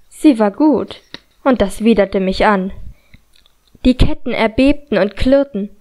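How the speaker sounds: plain reading voice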